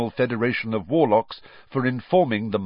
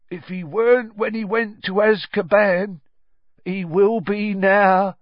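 slow, raised character voice; 12 semitones higher, 7 dB louder than usual. A narrator's reading. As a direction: draw the words out heavily. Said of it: "said Ern in his slow voice".

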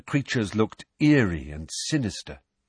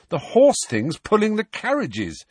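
higher and louder, exasperated; impatient; 11 semitones higher, 4 dB louder than usual. A narrator's reading. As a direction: push the point impatiently. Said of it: "said Harry impatiently".

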